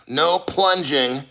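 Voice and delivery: normal talking voice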